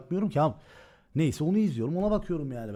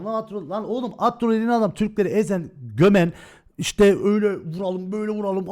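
loud, heated burst with a near-shouted gömen; angry outburst; 3 semitones higher, 8 dB louder than usual. Real, not acted. Streamer fuming about a rival who crushed Turkish players, loud and angry. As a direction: spit out the accusation, loud and angry.

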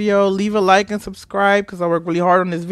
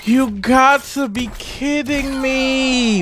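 slow, higher-pitched incredulous drawl; drawn-out disbelief; 5 semitones higher, slower than usual. Real, not acted. Player who can't believe the game, incredulous drawl. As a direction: stretch it out in disbelief.

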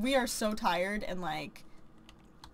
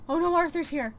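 loud panicked oh no; 3 semitones higher, 6 dB louder than usual. Real, not acted. Player panicking as Arthur walks in, loud alarmed cry. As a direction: blurt it out, caught red-handed. Sim nearly caught cheating.

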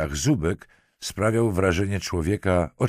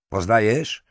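raised, louder demand; sharp question; 5 semitones higher, 5 dB louder than usual. A narrator's reading. Lieutenant forces the marquise to look at a corpse, sharp. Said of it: "zapytał ostro".

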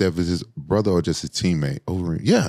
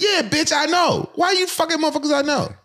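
much higher, indignant accusing rant; retold anger. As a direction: call her out, offended. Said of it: "I was like".

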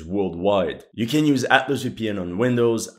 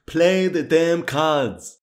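exasperated; 6 semitones higher, much slower than usual. Real, not acted. Impatient with the game's cutscenes.

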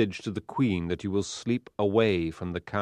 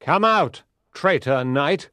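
loud challenge dropping low; forceful, accusing knight's call; loud cry; 4 semitones lower, 5 dB louder than usual. Acted. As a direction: call him out through a door. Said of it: "he cried loudly".

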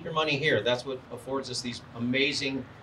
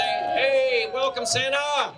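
higher, louder festive greeting, slowed; bright call of welcome for the crowd to copy; excited welcome; 10 semitones higher, 4 dB louder, much slower than usual. Shown as a demonstration. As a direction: lead the crowd in cheering Santa in. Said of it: "I think we need to say".

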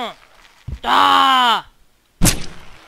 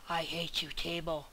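much softer grumble at the table; muttered hate; 7 semitones lower, 21 dB quieter than usual. Real, not acted. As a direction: mutter the grudge under your breath.

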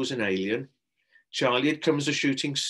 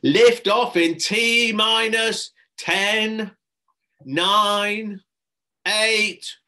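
high, loud, slow countdown; loud announcer count; called-out countdown; 11 semitones higher, 9 dB louder, much slower than usual. A narrator's reading. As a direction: call the launch count to the room. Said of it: "a voice called out".

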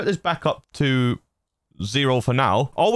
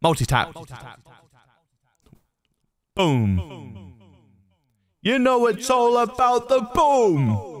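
layered repeating echo; multi-tap echo; 8 semitones higher, slower than usual. Shown as a demonstration. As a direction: stack the echoes on the boom. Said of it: "let's go to multi-tap".

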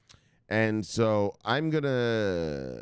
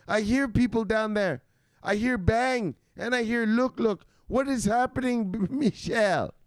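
high-pitched scolding mom voice; shrill mock mom yelling at her son; mother; 9 semitones higher than usual. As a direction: play the nagging mother calling down the stairs. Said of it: "his mother doesn't talk like that".

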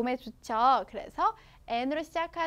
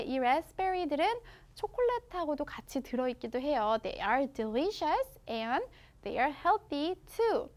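ordinary delivery

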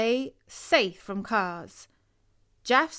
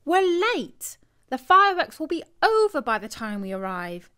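high, louder worried outburst; anxious complaint; 6 semitones higher, 5 dB louder than usual. A narrator's reading. Boy worries aloud to the family, high and loud. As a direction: fret that they've missed it. Boy frets about missing fireworks.

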